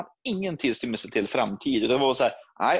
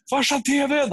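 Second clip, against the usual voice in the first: high-pitched yell to Dad; re-enacted kid's urgent call; 14 semitones higher, 3 dB louder than usual. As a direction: holler it across the house, pitched way up. Child calls dad about TV.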